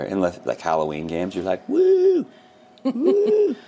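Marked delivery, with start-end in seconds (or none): 1.7-3.5 high, loud mock woo; mock cheer. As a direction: whoop like a fan at a Halloween game. Mock Halloween game cheer.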